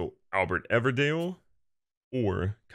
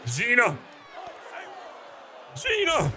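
high, sharp cry of the player's name; exasperated call; 13 semitones higher, slower than usual. Real, not acted. Gamer cries out to the pitcher Gina, exasperated.

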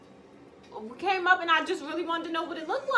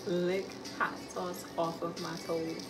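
whisper; 10 semitones lower, 14 dB quieter than usual. Acted. Whisper challenge phrase again.

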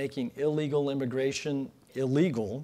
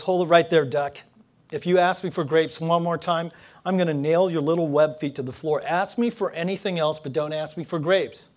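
angry manager; 3 semitones higher, 7 dB louder than usual. Acted. Manager loses temper at duck.

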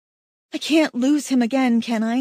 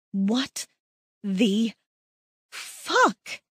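stunned; much slower than usual. Acted. Shocked when he kisses her.

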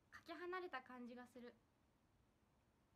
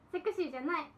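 loud; 14 dB louder than usual. Real, not acted. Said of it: "これってセクシーって言いますか".

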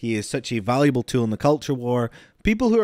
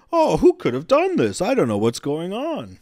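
mock innocent; 6 semitones higher, 5 dB louder than usual. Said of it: "It's like".

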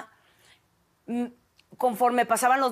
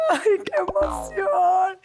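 mother's emotion; 8 semitones higher, much slower than usual. Retold or imitated. Voicing her crying mother.

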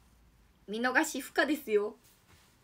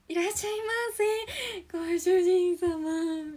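high slow stiff maid welcome; awkward maid greeting; 5 semitones higher, much slower than usual. Retold or imitated. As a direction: fumble the maid line slowly. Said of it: "ってなっちゃいそうで怖い".

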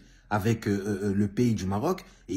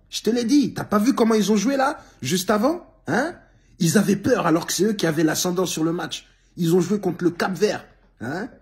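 worked up rant; 9 semitones higher, 6 dB louder than usual. Real, not acted. Mocking Senegal's nervous play.

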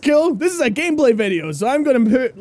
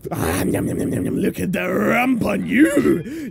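creepy leer; 5 semitones lower than usual. Pretend. Ogling target creepily.

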